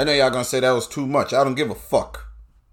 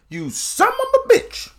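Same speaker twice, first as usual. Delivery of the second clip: much higher, slow squeal; Bernie Mac impression. Comic quoting Bernie Mac's line in a squeal. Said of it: "how Bernie Mac say".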